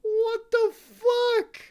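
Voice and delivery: high, slow "What the fuck?" with "the" peaking; squealed disbelief. Real, not acted. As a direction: stretch it up into a squeal.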